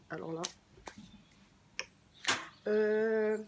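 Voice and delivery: usual speaking voice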